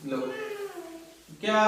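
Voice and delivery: normal talking voice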